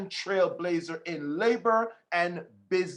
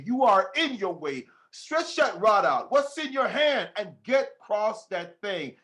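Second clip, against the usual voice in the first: fiery preaching; 3 semitones higher than usual. Real, not acted. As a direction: fire up the congregation like a preacher at full force. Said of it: "I'm getting too excited right now".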